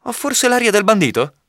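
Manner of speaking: very high-pitched rhetorical question; protesting question. A narrator's reading. Robin to the unconvinced gentleman, protesting question.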